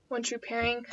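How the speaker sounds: ordinary delivery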